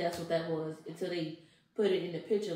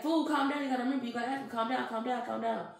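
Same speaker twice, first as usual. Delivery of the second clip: loud, high urging to calm down; urgent calming; 6 semitones higher, 5 dB louder than usual. Retold or imitated. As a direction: tell her firmly to calm down. Friends calming her on phone.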